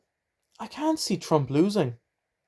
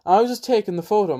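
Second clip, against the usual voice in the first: ordinary talk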